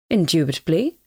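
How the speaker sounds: a dignified, lofty Indubitably; with dignity. A narrator's reading. Koroviev answers the doorkeeper with lofty dignity. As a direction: answer like a gentleman insulted by doubt. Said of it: "replied Koroviev with dignity".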